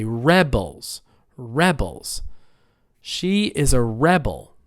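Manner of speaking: "rebel" and "Rebels" said REBel, REBels; first-syllable stress. Shown as a demonstration. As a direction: hit REB, let the end of "rebel" go weak. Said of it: "It's on the first syllable".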